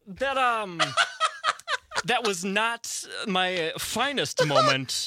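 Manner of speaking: laughing through the words; slow, amused confession; sheepish laughing admission. Real, not acted. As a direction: own up slowly through a laugh. Sheepishly owning up to wrong text.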